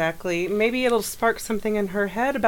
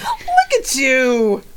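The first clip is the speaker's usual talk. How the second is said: laughing tease; 5 semitones higher, 6 dB louder, much slower than usual. Real, not acted. Teasing after emotional story.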